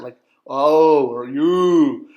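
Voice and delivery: "oh" and "you" drawn out slow and loud, back in the throat; demo oh and you. Shown as a demonstration. Teacher sounding out the glyph, slow throaty demo. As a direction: stretch "oh or you" like a Cockney vowel. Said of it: "like a Cockney accent".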